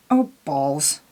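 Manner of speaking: normal talking voice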